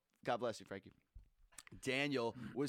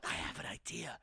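secretive whisper; 6 semitones lower, 6 dB quieter than usual. Retold or imitated. Kid announces secret plan.

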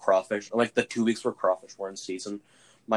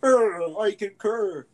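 much higher, louder snooty voice; fancy person voice; 11 semitones higher, 6 dB louder, much slower than usual. Retold or imitated. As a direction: play a pompous rich person agreeing. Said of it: "that's what fancy people sound like".

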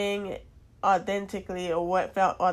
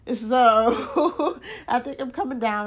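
laughing through the words; giggling while talking; 5 semitones higher, 6 dB louder than usual. Real, not acted. Laughs at own joke.

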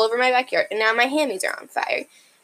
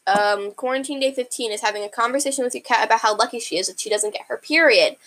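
ordinary delivery

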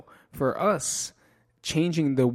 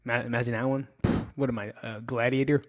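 ordinary talk

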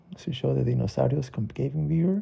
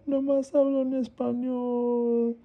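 put-on crying child; 11 semitones higher than usual. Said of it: "llorando y se fue a su cama".

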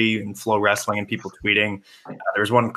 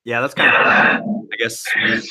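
echoing; 3 dB louder than usual. Real, not acted. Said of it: "sorry, I'm echoing in yours".

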